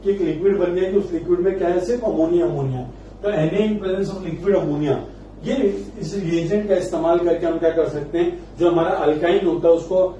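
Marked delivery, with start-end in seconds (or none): 3.2-5.0 slow dictation. Shown as a demonstration. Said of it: "dictating the reagent to students".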